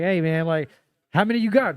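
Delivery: loud, high hustler calling people in; pushy ticket tout. Retold or imitated. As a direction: wave them in like a door guy. Acting out the ticket guy.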